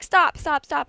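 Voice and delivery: loud, high yell at her brother; screaming stop. Retold or imitated. Kid yelling at her brother to stop swinging the hammock, loud panicked shout. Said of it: "And I'm screaming at him".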